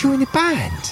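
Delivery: put-on very high, surprised kid; excited young girl. Girl questions the band, high surprised kid voice. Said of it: "She goes".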